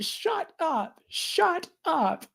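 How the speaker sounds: very high, playful squeal; girlish giddy protest; giddy disbelief. Acted. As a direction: squeal it in delight.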